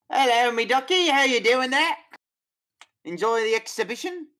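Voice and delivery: high, loud cockney carnival barker. Acted. Showman greeting visitors at the tunnel's end in cockney. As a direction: hawk the show like a London barker. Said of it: "Terrible accent, eh, mate?".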